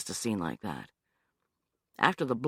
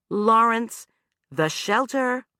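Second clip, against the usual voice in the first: prompting; 9 semitones higher, 5 dB louder, slower than usual. Acted. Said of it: "she prompted".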